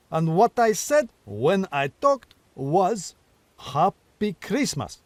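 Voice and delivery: slow, pointed and a touch irritated; with asperity. Acted. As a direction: spell it out with mild annoyance. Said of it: "with some asperity".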